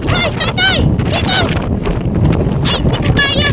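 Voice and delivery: high, frightened cries; pleading, scared out of her wits; real panic. Real, not acted. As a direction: call to her father, terrified of the crossing. Scared crossing bridge in wind.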